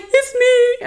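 very high, thrilled little squeal; high-pitched fangirl answer; acted squeal. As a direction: squeak it out like a starstruck fan. Acts out her thrilled answer.

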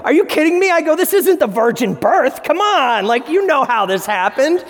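high, incredulous blurt; blurted disbelief. Retold or imitated. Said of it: "I blurted this out".

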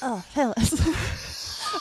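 a slow, timid cartoon-boy plea; Butters voice. Cohost does Butters, very slow and timid. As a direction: stretch it out nervously. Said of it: "Do you wanna try Butters?".

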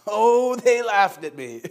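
loud, high, drawn-out THEY LAUGHED; drawn-out emphasis. Real, not acted. Relishing being laughed at.

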